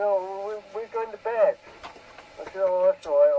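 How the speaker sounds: usual speaking voice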